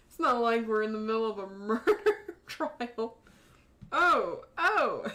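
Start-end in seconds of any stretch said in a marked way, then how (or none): 3.9-5.0 startled yelp in a fresh character voice; new character voice. Acted. Judge startled as the stranger bursts in, yelping. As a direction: try out a new voice with a sudden cry. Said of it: "I don't know what to do with this voice yet".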